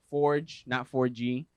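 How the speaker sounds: ordinary talk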